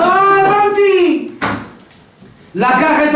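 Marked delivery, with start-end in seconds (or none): 0.0-1.3 slow, drawn-out high aha; knowing realization. Acted. The king sees through the thieves, slow knowing aha. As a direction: stretch it out as the penny drops.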